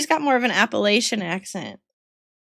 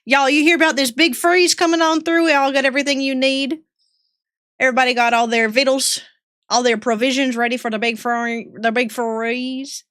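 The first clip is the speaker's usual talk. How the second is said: folksy Southern warning about the freeze; Southern accent. Pretend. Streamer playing a Southern local warning chat. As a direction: talk like a country neighbour stocking up. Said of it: "The accent's back".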